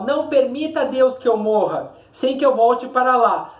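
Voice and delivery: measured, rhythmic declamation; poem recitation. Shown as a demonstration. Teacher reading the poem aloud to students, declaiming.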